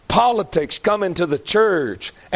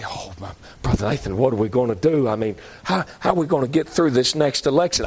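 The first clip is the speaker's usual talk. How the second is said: worried church member; 7 semitones lower than usual. Retold or imitated. Church member asking the preacher about the election, mock worry. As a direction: mimic a helpless worrier in a low voice.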